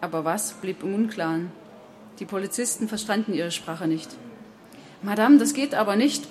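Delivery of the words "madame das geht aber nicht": loud, raised objection; higher and louder, putting a stop to it; policeman's bellow. A narrator's reading. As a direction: bellow a firm protest at her. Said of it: "brüllte Sergo".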